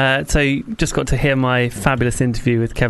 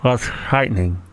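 mouth held open; 3 semitones lower than usual. Real, not acted. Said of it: "the mouth is wide open".

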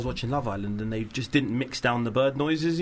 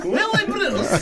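laughing imitation; 4 dB louder, much slower than usual. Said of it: "She sound like Borat".

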